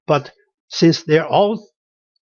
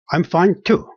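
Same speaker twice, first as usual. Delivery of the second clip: usual speaking voice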